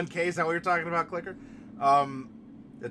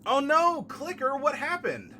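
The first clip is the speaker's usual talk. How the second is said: high, loud sudden worry; alarmed concern; 10 semitones higher, 5 dB louder than usual. Real, not acted. Reacting to Clicker's bad news, high alarmed concern. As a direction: gasp and reach out to a friend.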